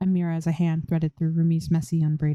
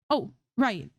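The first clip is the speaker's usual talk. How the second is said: quick; 6 semitones higher than usual. A narrator's reading. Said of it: "Zoe says quickly".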